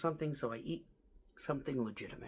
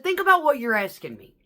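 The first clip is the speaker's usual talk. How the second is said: exasperated scolding; 6 semitones higher, 7 dB louder than usual. Retold or imitated. Scolding coworker.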